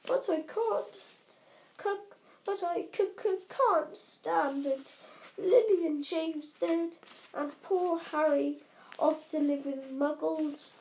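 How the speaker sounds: loud stammering blubbering giant; sobbing. Acted. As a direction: wail it, choking on words. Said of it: "sobbed Hagrid".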